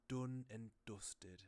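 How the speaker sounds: Northern English vowels, drawn out slowly; Northern English accent. Retold or imitated. Streamer quoting a game hero, Northern English accent. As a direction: DONE and DUSTED with a Northern English "oo". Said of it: "in Northern England, they pronounce".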